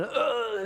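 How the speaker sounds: high, imitated grunt of falling; mock groan as if tripping; stumbling groan. Imitates old man stumbling and falling.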